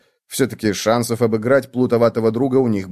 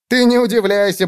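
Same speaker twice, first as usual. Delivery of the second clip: high, teasing bug voice; high playful ribbing; amused bedbug; 10 semitones higher, 4 dB louder than usual. A narrator's reading. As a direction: tease a friend, pitched high. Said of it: "засмеялся Клоп".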